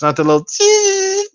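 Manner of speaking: high, squeaky mock fart voice; squeaky little fart. Mocking the little farts his wife doesn't do, squeaky.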